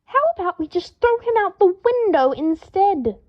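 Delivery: high, loud contemptuous snap; spat out angrily. A narrator's reading. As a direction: spit the words out in anger. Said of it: "Draco spat".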